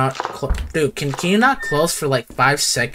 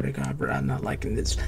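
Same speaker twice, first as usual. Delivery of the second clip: much lower and softer, uneasy mumble; low dread; 12 semitones lower, 11 dB quieter than usual. Real, not acted. Player dreading the kitchen, low and soft. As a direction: sink into a low uneasy murmur.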